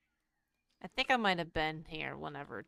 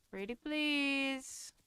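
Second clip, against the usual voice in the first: higher, slow, sugary plea; sweetly insistent; 8 semitones higher, much slower than usual. Pretend. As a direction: sing it sweetly, begging nicely. Begging the game for a door.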